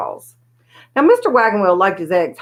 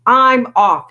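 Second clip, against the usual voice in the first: louder parting shout; yelled; 4 semitones higher, 6 dB louder than usual. A narrator's reading. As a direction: call it out as you storm off. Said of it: "he yelled".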